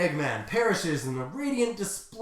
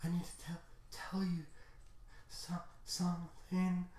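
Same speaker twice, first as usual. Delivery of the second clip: very soft, halting last words; acted failing breath, stammering quietly; dying hero; 13 dB quieter than usual. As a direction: struggle to get each word out. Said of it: "on the last leg of his life".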